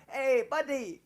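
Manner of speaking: high, loud mock Indian accent; Indian accent impression. Son greeting gas station clerk, high mock Indian accent. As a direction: holler it in a put-on Indian accent. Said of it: "my son even does the accent".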